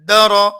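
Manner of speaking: द र run together as loud "da-ro"; two letters joined. Shown as a demonstration. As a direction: join short द to a full, rounded र. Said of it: "दोनों को मिला, मिलाकर पढ़िए". (द र मिलाकर, "दरो")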